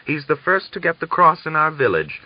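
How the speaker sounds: put-on high, boastful old man voice; proud. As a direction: declare it with pride. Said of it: "he declared proudly".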